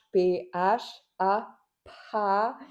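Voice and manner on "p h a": P H A spelled out letter by letter, slowly; P H A, each letter drawn out. Shown as a demonstration. Teacher slowly spelling P H A for the class. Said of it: "il y a comme un H".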